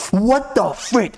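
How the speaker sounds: ordinary talk, nothing marked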